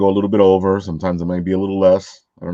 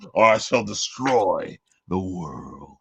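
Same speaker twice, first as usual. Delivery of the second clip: villain voice; 4 dB quieter, much slower than usual. Pretend. Villain threat for ringtone.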